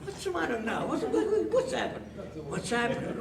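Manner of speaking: high, stammering exasperation; acted pestered roommate; exasperated. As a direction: sputter the question at a high pitch. Roused again by the bedmate.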